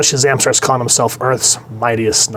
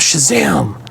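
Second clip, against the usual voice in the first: higher, half-hearted battle cry; mild mock scream; 5 semitones higher than usual. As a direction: imitate a hero's shout without full volume. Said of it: "And he screams".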